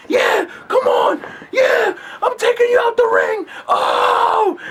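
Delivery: high slow pretend yell; mock wrestling shout.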